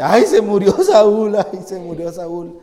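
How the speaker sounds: slow, exaggerated fake lament with a laugh; mock wailing mourner. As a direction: ham up a hypocrite's crocodile tears. Predicador imita al hipócrita lamentándose. (lamento fingido y exagerado)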